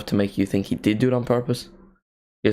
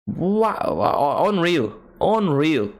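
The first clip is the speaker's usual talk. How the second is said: amazed; 6 semitones higher, much slower than usual. Real, not acted. Reacting to the goal.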